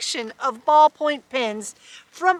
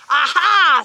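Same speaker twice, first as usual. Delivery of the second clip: triumphant aha; 4 semitones higher, 7 dB louder than usual. A narrator's reading. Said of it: "said Miss Swamp".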